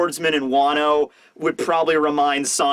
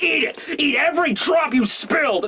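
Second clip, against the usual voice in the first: high, hammed-up angry order; furious command; 9 semitones higher than usual. Sanji shoving noodles down his throat, furious high command. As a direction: force it on them in mock rage. Sanji force-feeds the beaten man.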